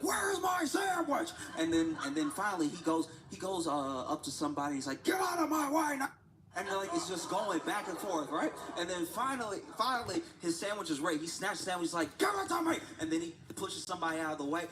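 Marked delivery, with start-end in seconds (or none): 0.0-1.3 angry shout. Retold or imitated. Angry man stomping in restaurant. 5.0-6.1 high, loud mock bark; angry demand. Angry customer confronting somebody, high loud demand. Man gets in someone's face. 12.2-12.8 high, loud grabbing yell; snatch it with your voice; angry shout. Retold or imitated. Customer grabbing his sandwich, high angry shout.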